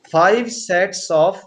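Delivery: very slow, phrase by phrase; slow dictation. Real, not acted. Teacher dictating what pentameter means, slow dictation. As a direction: dictate slowly so they can write.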